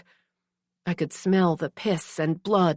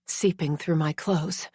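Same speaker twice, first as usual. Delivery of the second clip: ordinary delivery, nothing marked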